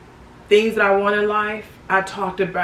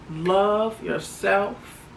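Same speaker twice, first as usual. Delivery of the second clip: tender; much slower than usual. Real, not acted. Valentine's message to viewers.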